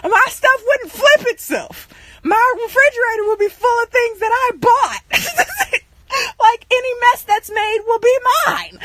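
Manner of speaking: high-pitched complaining rant; re-enacted tirade in a high voice; acted rant. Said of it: "I just go on this rant".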